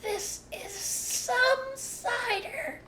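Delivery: very high, very slow wonder; awed drawl. A narrator's reading. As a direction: savor it, stunned. Cub reeling from cider.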